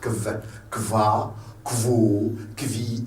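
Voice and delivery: "kv, kva, quo, kvi" each with k plus v. Shown as a demonstration. Teacher drilling German Q for learners, Q as K-V. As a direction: separate syllables, "quo" said kvo. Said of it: "Donc, on la prononce".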